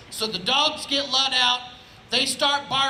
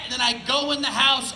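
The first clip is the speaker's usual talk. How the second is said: ordinary delivery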